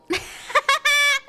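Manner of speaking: high, bubbly laugh; a run of real giggles, pitched high. Real, not acted. Laughs at own example.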